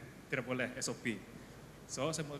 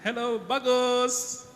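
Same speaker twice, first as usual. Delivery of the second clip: slow, high, beaming hello; cheerful greeting; 8 semitones higher, 6 dB louder, much slower than usual. Shown as a demonstration. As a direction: stretch out a happy greeting. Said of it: "Lepas tu".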